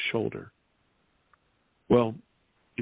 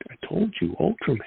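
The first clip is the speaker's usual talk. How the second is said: put-on much higher, sheepish little-boy voice; 8 semitones higher than usual. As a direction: sheepishly insist it was him, pitched up like a child. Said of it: "I looked around sheepishly".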